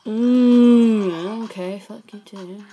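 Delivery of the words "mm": loud, long groan of frustration; frustrated groan. Real, not acted. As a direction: groan loudly through closed lips.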